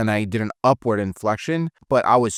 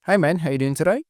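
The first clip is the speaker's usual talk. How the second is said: higher, lightly imitated Australian uptalk; a mock Australian greeting, pitched up; Australian lilt; 6 semitones higher, faster than usual. Trainer joking about uptalk in Australia, imitated Australian lilt. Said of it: "it's not an Australian accent, even though in Australia".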